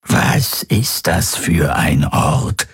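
slow, strange rasping whisper; a slow alien whisper; whispery creature voice. A narrator's reading. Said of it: "seine Flüsterstimme".

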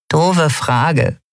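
lightly voiced cheeky remark; grinning. A narrator's reading. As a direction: say it with a grin you can hear. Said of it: "Nelen grinste".

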